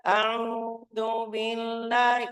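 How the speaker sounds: high, slow, nasal chant; held-back, humming recitation; not open enough. Shown as a demonstration. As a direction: chant with a narrow mouth. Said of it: "Kalau kurang buka".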